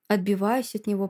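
usual speaking voice

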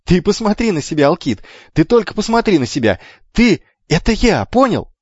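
put-on high, loud, urgent boy; excited discovery. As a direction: press it on your brother excitedly.

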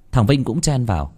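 usual speaking voice

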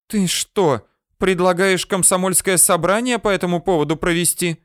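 high-pitched, incredulous female voice; indignant interruption. A narrator's reading. She interrupts his joke about a meeting, indignant interruption. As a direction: cut in with a high, disbelieving question. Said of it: "перебила она меня".

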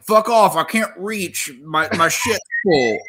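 high, strained mock-guy yelp; guys' stall reply. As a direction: play a guy yelling back from the stall. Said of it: "all the guys are like".

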